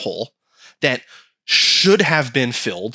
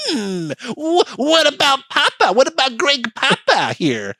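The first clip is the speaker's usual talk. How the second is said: high, wheedling mock plea; whiny California; 10 semitones higher, slower than usual. California asking for its share, high and wheedling. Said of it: "California to be like".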